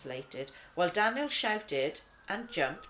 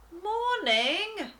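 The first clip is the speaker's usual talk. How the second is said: much higher, louder drawn-out moan; 7 dB louder than usual.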